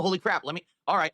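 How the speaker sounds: usual speaking voice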